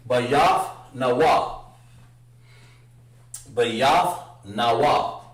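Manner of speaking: Bayath Nawa, slow and deliberate; slow model pronunciation. Shown as a demonstration. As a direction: pronounce Bayath Nawa slowly. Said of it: "So she said Nawa".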